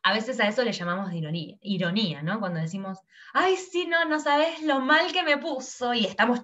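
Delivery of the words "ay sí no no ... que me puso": much higher sarcastic "Ay, sí, no sabes lo mal que me puso"; ironic. Shown as a demonstration. Teacher demonstrating irony, higher mock dismay. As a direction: gush with fake pity, secretly delighted. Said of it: "lo decimos con lo contrario".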